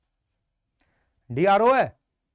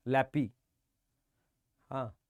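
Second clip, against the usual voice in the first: mockingly quoting lappy softer and lower; mocking student; 8 semitones lower, 9 dB quieter, slower than usual. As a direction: echo the kid's lappy with a soft mocking tone. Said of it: "अंग्रेजी मार रहा है मुंह से".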